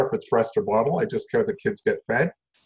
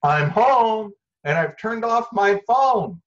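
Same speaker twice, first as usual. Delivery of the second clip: slow, loud, high call-out; shouting into house; 8 semitones higher, 6 dB louder, much slower than usual. Shown as a demonstration. Parent arriving home to the family, shouted announcement. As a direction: holler it through the whole house. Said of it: "shout into the house".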